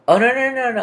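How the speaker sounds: high, loud burst of no's; panicked at getting the story wrong; sudden alarm. Real, not acted. Storyteller realizing she skipped ahead, high alarmed no's. Catches own mistake mid-story.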